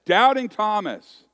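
loud, slow, high stress on Doubting; emphatic. Real, not acted. Pastor naming Thomas's nickname for the congregation, loud and emphatic. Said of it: "the moniker that he is".

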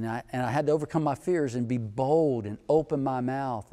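ordinary delivery

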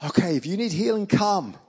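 retold confident invitation to a crowd; bold. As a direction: call them forward boldly. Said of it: "I'm getting really bold now".

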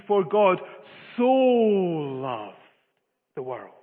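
"so" swelled loud and very long, then dropping away; stress on so. Shown as a demonstration. Preacher shows how we read the verse, hugely emphasizing "so". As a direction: make "so" a huge drawn-out volume of love. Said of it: "And so we emphasize the word".

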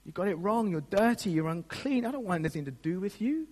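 hammed-up smug disdain, pitched a little high; mock a smug, dismissive judge looking down from above. Preacher imitating a God who rejects humanity, smug. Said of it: "smugly going".

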